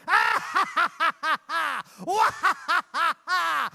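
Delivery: evil cackle then a growled threat; villain laugh. Shown as a demonstration. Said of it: "You take a big step forward and you go".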